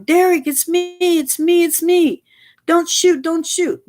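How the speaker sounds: high, frantic plea not to be shot; desperate high-pitched begging; panicked friend. Retold or imitated. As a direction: squeal it out like a scared man facing a gun. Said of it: "his friend in the back was going".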